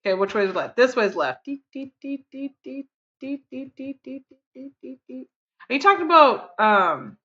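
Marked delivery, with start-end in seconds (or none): 1.4-5.3 soft, high, even beeps; quiet, high staccato deet-deet-deet; little deet noises. Pretend. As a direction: tick along in tiny repeated beeps. Vocal noise while moving along in game.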